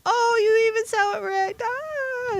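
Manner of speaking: very high, gushing re-enactment; high mock-swooning delight trailing into "ah"; retold delighted squeal. Retelling own delighted reaction.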